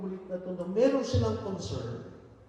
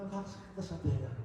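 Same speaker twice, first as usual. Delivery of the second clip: fast and softer, almost to himself; quick repeated complaint; 3 semitones lower, 8 dB quieter, faster than usual. Retold or imitated. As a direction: quieter fast echo of her words.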